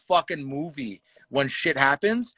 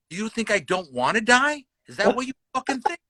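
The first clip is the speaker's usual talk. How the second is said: pitched-up, confrontational questioning; retold mad challenge; 7 semitones higher than usual. Comic tells heckler he'd die fighting, mad challenge. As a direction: act out your own psycho dare, rising higher. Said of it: "when I get mad".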